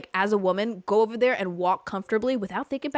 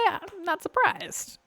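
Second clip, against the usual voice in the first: sassy quip; 10 semitones higher, slower than usual. Retold or imitated. Sassy remark to staring men.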